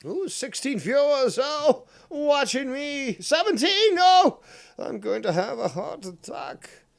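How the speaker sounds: high, loud put-on excitement; hammed-up squeal of amazement; mock-thrilled. Streamer marvelling at the viewer count, mock-thrilled.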